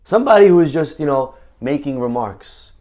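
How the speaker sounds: ordinary delivery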